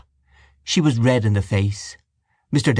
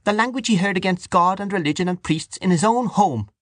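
high, righteous accusation; high-voiced, aggrieved tirade; hot indignation; 10 semitones higher than usual. A narrator's reading. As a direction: press the charge heatedly, pitched high. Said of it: "said Dante hotly".

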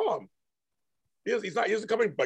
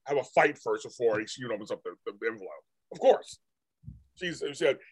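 ordinary talk

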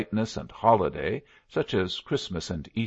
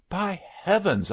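high, delighted outcry; delighted cry; 12 semitones higher than usual. A narrator's reading. Narrator hearing Jenks is in it, delighted cry. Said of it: "I cried in unmixed delight".

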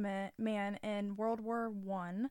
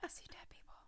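whisper; 17 dB quieter, faster than usual. Retold or imitated. Quoting The Sixth Sense line.

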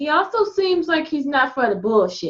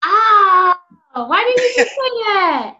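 exasperated mock cry; 6 semitones higher, 5 dB louder, slower than usual. Real, not acted. Mock outrage at late answer.

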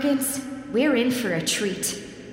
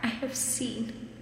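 ominous hushed voice; 8 dB quieter than usual. Acted. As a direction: whisper it like a haunted man. Dramatic impression after intro.

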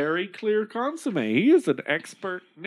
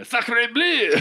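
mock French; 4 semitones higher than usual.

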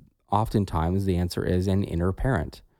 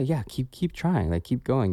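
encouraging voice; 6 semitones higher than usual. Retold or imitated. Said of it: "you would say".

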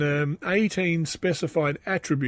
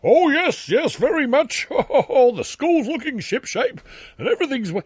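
much higher, louder silly Mister Bean-ish voice; hammed-up high Mister Bean headmaster; 10 semitones higher, 5 dB louder than usual. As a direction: mimic the chipper headmaster as a Mister Bean type. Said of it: "he's a bit like Mister Bean".

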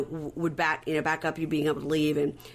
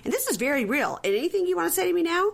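imitated confrontation, pitch climbing high; challenging threat; 12 semitones higher than usual. Host acting husband threatening prosecution, challenging. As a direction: press for an answer as the aggrieved husband, higher.

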